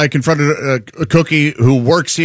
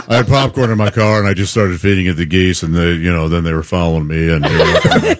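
low, mumbly impression of a guilty colleague; sheepish confessing coworker; 9 semitones lower than usual. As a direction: drop the voice way down and own up haltingly. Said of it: "and he said".

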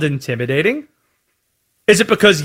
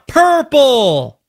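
drawn-out, higher mock stadium yell; imitated crowd call, pitched up; 5 semitones higher than usual. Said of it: "They yell".

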